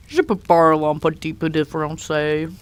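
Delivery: French spoken in a thick American accent; French with a heavy American accent. Shown as a demonstration. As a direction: speak French like an American tourist. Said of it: "doing it in American accent".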